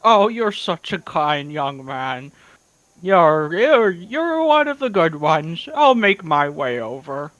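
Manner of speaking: put-on slow, creaky old-timer praise; doddering old man, slow and fond; old prospector. Old owlin character replies.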